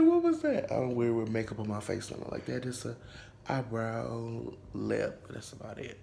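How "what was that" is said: startled squeak. Real, not acted. Startled by own laugh.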